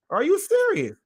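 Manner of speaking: high-pitched disbelieving "Are you serious?"; can't believe what he's hearing; incredulous. Real, not acted. Reacting to someone refusing to speak up, incredulous.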